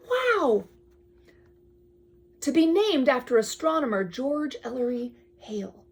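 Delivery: storybook read-aloud, drifting to a hush; bedtime-story reading; reading to a child. Acted. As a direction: read the book to your kid. Parent reads picture book aloud.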